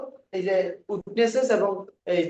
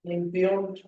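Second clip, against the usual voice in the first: very slow, long gaps between phrases; dictation; much slower than usual. Shown as a demonstration. Teacher giving students judgment wording to write, slow halting dictation. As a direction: dictate a word or two, then wait.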